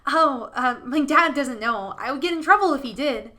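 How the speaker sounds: put-on a hesitant child, owning up; a hint of a sheepish little boy; lightly voiced scarecrow boy. Scarecrow boy admits Dad doesn't know, slightly sheepish.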